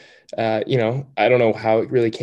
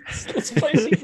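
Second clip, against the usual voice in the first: laughing through the words, pitched way up. Real, not acted. Repeating a friend's quote while cracking up with laughter.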